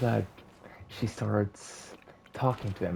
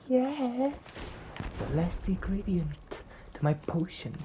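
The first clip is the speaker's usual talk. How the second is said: high, thrilled cry sinking back; gleeful yes; 10 semitones higher than usual. Acted. Cinderella finds the frog, starting high and gleeful. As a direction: gloat over the find. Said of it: "And she's like".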